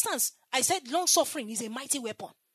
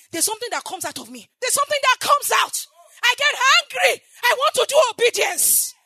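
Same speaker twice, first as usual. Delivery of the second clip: loud, high, full-force preaching; fiery preaching; 11 semitones higher, 13 dB louder than usual. Acted. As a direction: preach it out to the whole church.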